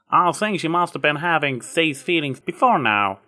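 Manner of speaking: a hint of a country farm mare, kindly; lightly voiced Applejack. A narrator's reading. Applejack brushing off Trixie's apology, lightly voiced country mare.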